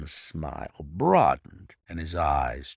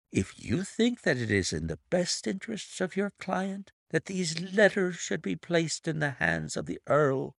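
raised, silky villain voice; smug blackmailer voice; 11 semitones higher than usual. Acted. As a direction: purr it out smugly, pitched up. Narrator voices Milverton.

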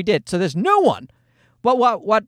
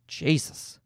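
ordinary talk, nothing marked

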